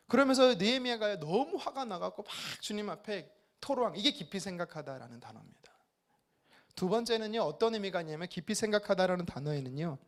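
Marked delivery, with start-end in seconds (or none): none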